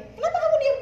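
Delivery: high, loud and pointed, playing the husband; mock husband demanding why she's silent. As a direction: throw the husband's accusing question back at her. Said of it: "Suami saya bilang gini".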